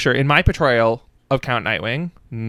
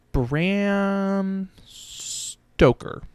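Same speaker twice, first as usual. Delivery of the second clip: "Bram Stoker" stretched very slowly, higher and softer; drawn-out; 7 semitones higher, 6 dB quieter, much slower than usual. Shown as a demonstration. Checking the author's name, "Bram Stoker" drawn out slowly. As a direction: hold each sound of "Bram Stoker" at length.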